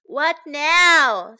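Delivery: mild acted exasperation; sighing. A narrator's reading. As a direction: sigh it out loudly. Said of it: "sighed Stella".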